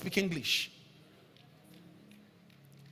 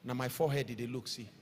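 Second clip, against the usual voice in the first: low, much softer joking aside; 10 semitones lower, 14 dB quieter, faster than usual. Real, not acted. Preacher teases the crowd about his forehead, low and soft. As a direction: drop right down and tease.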